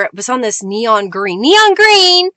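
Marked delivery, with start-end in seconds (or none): 1.4-2.3 high, loud burst of excitement; excited exclamation. Real, not acted. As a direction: call it out high and loud, stretching the last word. Exclaims the colour she named.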